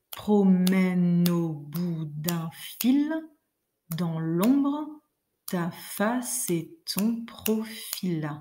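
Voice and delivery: «Promène au bout d'un fil» stretched slow, feet marked; very slow, each foot counted; slow scansion. Shown as a demonstration. Teacher reading the verse slowly in rhythm. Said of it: "si je claque un peu dans les doigts".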